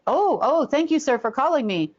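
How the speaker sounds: high, gushing mock gratitude; pretend phone call. Pretending to thank a caller while escaping, high mock deference. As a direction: fake a grateful phone conversation. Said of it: "you start talking".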